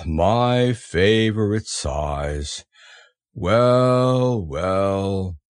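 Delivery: sighing, low, drawn-out musing; resigned, mournful donkey voice; sad Eeyore. A narrator's reading. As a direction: sigh and let each word drag. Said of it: "he said to himself sadly".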